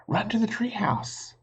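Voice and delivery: urgent call. A narrator's reading. Jack urging Annie to flee.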